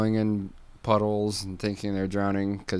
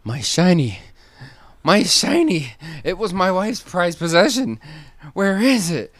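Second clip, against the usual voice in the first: much higher and louder, desperate cry; panicked wailing mole; 10 semitones higher, 11 dB louder than usual. Acted. Mole searching the rubble for his wife's shiny, desperate wail. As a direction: wail for the lost treasure. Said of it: "he's like".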